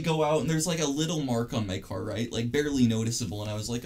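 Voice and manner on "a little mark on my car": usual speaking voice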